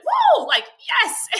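high, loud mock whoop; cheering. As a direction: imitate his triumphant celebration. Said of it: "he would start cheering".

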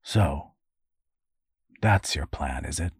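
low, subdued resignation; understated, disappointed question; note of sadness. A narrator's reading. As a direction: say it with long pauses, a little sad. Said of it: "a note of sadness in his voice".

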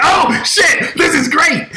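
high-pitched, laughing retold glee; giddy, high exclamation; thrilled outburst. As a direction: act out delighted shock, pitch way up. Reenacts delighted reaction to argument.

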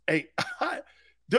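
ordinary talk, nothing marked

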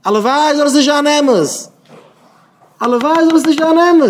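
loud, high impassioned cry; fervent outcry. Real, not acted. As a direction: call it out high and drawn, with force. Fervent wish called out twice. (הויכער, ברענענדיקער אויסרוף)

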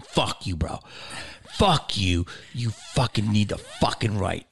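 low, controlled mock confrontation; retold firm retort. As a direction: answer the outburst low and deliberate. Retells own answer to the outburst.

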